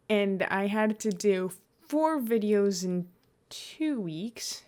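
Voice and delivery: ordinary delivery, nothing marked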